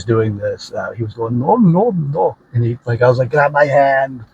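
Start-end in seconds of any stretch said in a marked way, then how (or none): 1.4-2.4 high, escalating refusals imitating an Indian man; repeated high-pitched cries of refusal; retold Indian character. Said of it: "he was an Indian". 3.3-4.2 raised Austrian action-hero command; a lightly done Austrian movie-star order; Arnold Schwarzenegger impression. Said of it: "I'm not doing the best Arnold".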